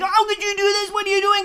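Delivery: high, loud panicked scream; a mother screaming at her child; retold scream. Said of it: "yelling, screaming".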